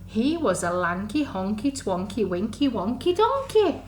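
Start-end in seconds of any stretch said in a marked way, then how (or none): none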